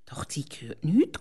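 ordinary delivery

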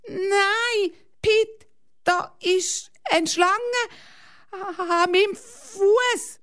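very high, slow crying plea; terrified whimper. A narrator's reading. Girl tells uncle the snake is at her foot, high and crying. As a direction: cry out slowly in fear. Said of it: "Hanna panics about snake".

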